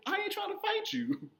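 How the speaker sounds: very high mock-pleading kid; imitating a scared child; retold frightened protest. Child protesting to threatening father.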